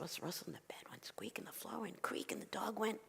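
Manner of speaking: whispered, very soft list of noises; hushed suspense. A narrator's reading. Narrator as rabbi listens eyes closed, hushed. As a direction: whisper each sound in turn.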